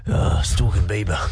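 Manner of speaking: low gruff prisoner answering; gruff inmate voice. Pretend. Cellmate asks what you're in for, low gruff inmate voice. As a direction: growl it like a hardened cell mate. Imagined prison cell chat.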